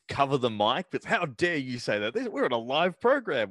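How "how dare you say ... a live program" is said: mock indignant Gene. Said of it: "Mean Gene gets really outraged".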